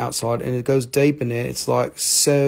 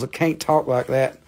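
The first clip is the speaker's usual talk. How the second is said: ordinary delivery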